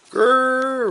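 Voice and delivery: put-on high, loud playful kid roar; wild roar. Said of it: "roared Ollie, even wilder".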